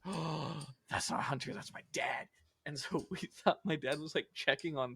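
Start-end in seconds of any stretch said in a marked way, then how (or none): 0.1-2.3 whispered alarm. Retold or imitated. Realizing the footsteps are dad, frightened whisper. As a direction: breathe it out in fear. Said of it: "I said".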